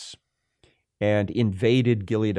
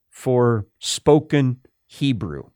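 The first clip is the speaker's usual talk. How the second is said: normal talking voice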